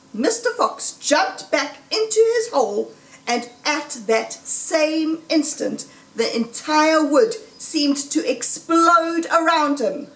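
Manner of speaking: ordinary delivery, nothing marked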